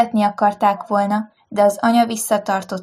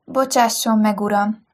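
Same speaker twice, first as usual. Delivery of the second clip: usual speaking voice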